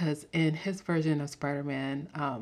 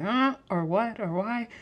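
higher, baffled "or what, or why"; mock baffled viewer; 6 semitones higher than usual. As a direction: play a confused viewer. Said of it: "you're like".

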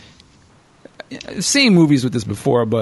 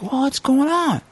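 maintenance man; 11 semitones higher, slower than usual. Retold or imitated. Maintenance man finds the girl.

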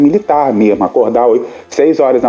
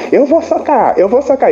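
high-pitched mock threat, said twice over; imitating a hothead straining to be held back; riled-up brawler; 10 semitones higher than usual. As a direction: play the guy itching to throw punches. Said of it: "fica igual aqueles caras assim".

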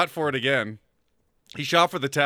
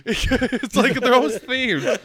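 laughing through the words; 8 semitones higher, 5 dB louder than usual. Real, not acted. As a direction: talk while cracking up. Laughing while talking.

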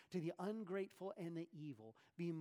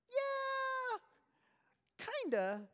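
very high, drawn-out doubting yeah; a long high sceptical yeah; skeptical; 6 dB louder, much slower than usual. Real, not acted. Doubting reply to the claim.

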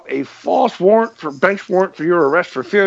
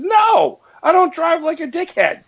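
indignant shout; 10 semitones higher than usual. Retold or imitated. Retelling his answer to the officer, a high indignant shout. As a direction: shout it with righteous scorn.

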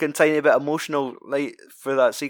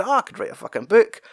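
imitating a lightly higher, quicker storyteller; mock film character; 8 semitones higher, 3 dB louder, faster than usual. Tommy's friend telling his day, mock storytelling voice. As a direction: toss it off like a bragging anecdote.

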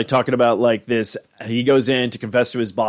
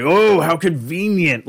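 slow, higher mock amazement; Rick; 5 semitones higher, slower than usual. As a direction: draw out a put-on "oh, how convenient". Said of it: "I just love how Rick is like".

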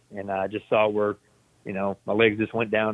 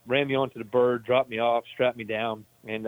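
ordinary delivery

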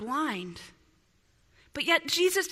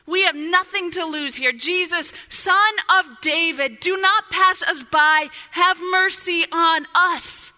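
crying out in desperation; 4 semitones higher, 8 dB louder than usual. Acted. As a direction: beg at full voice, building to the end. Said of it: "cry out in desperated, desperation".

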